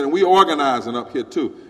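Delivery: rally the crowd; loud retold rallying; loud declaration. Lady's retold speech.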